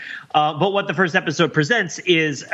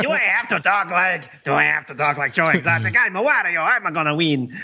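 Wario voice; 3 semitones higher than usual. Pretend. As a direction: ham it up like Wario. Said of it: "all accents approach Wario".

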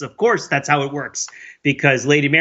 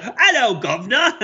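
high-pitched comic Cockney accent, imitating the actress; mock Cockney urchin; 8 semitones higher than usual. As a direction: do an exaggerated Cockney street-child greeting. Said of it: "talks like a small child with fake charcoal on its face".

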